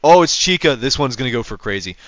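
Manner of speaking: ordinary talk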